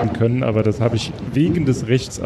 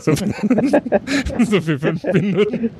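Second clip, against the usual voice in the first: laughing; 7 semitones higher, much slower than usual. Real, not acted. Laughing about brief popularity.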